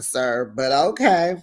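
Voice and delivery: ordinary talk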